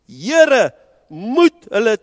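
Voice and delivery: loud, high cry to the Lord. A narrator's reading. Preacher voicing Stephen crying out to his Lord loudly. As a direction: call it out at the top of the voice. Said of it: "hard uitgeroep".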